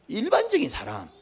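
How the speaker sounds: 일반적인 said up high; high first take. Shown as a demonstration. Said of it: "repeats phrase in two voices".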